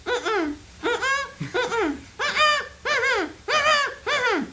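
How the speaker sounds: very high repeated mm-mms; mocking no hums. Manager refusing as the caller reads, high mocking hums. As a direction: hum an endless exaggerated no. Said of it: "and she was going".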